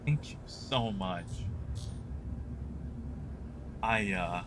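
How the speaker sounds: low, quiet, moved thanks; fighting back tears, quietly; choked up. Real, not acted. Choked up by viewer generosity.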